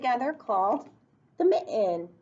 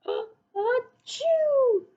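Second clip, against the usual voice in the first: big mock sneeze; 12 semitones higher than usual. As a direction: act out the bear's enormous sneeze. Bear gives an enormous sneeze.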